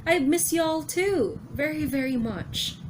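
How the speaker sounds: put-on high, slow, sweet girl's voice; cute Hiyori. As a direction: play the cute little sister, slowly. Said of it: "cute Hiyori".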